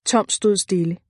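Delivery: ordinary delivery, nothing marked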